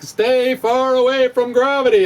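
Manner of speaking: high, put-on voice mocking the Higgs people; high mocking caricature of a physicist. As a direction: squeak it out like a dismissive expert.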